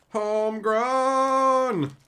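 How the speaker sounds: slow, high, loud stretched shout; drawn-out exclamation. Acted. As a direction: stretch it out with glee.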